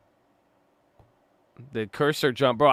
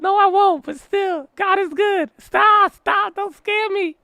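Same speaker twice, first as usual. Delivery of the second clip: much higher, tiny pleading voice; mock squeaky voice; 5 dB louder, slower than usual. Streamer replaying his scared plea to chat, squeaky and mock-pleading. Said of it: "I said".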